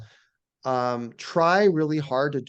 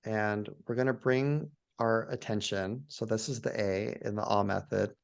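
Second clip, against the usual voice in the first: guided meditation; 2 semitones lower than usual. Shown as a demonstration. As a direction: gently guide listeners into awe, phrase by phrase. Leads a guided awe meditation.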